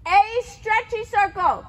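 projected test-caller's call. Real, not acted. Caller to rider on the next movement, calling out. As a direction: call it loudly across the arena.

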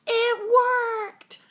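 very high joyful exclamation; high happy shout of success; delighted cry. Acted. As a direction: burst out with relief. Birds leave elephant's head.

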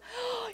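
a sudden sharp intake of breath; sharp gasp. Acted. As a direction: gasp in wonder before the news. Realizing Messiah has arrived.

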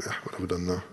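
usual speaking voice

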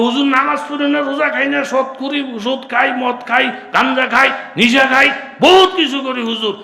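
high, full-force shouted tirade; loud indignant protest. Acted. Sinner insisting to hujur he is innocent, indignant shouting. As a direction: rattle off the sins at the top of the voice.

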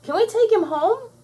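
put-on very high little-girl begging; squealed plea. A narrator's reading. Bubbles begging to keep the monster, squealing. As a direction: beg in a squeal. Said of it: "squealed Bubbles".

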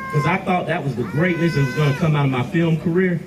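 normal talking voice

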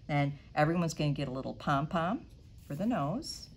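ordinary talk, nothing marked

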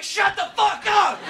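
high-pitched yell without real volume; mock yell. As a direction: act out yelling at passing fire trucks. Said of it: "I yell at fire trucks".

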